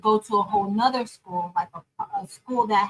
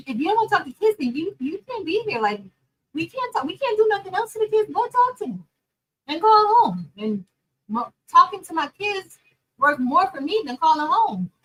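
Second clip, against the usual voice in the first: high and loud, building anger; heated rant; 7 semitones higher, 4 dB louder than usual. Retold or imitated. Teacher retelling the fight with the substitute, heated and loud. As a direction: let the frustration boil over.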